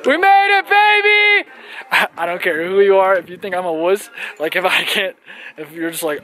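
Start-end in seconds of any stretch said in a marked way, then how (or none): 0.1-1.5 high, loud victory yell; excited shout. Real, not acted. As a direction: shout it like you won. Reaching the volcano summit.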